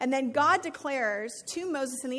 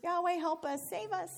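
higher, mock-panicked plea; panicked cry for help; 6 semitones higher than usual. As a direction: play the terrified Israelites, half-joking. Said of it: "I like these little thought bubbles".

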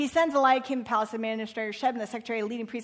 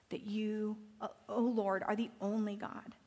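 hushed prayer; 6 dB quieter than usual. Acted. King's prayer ends hushed.

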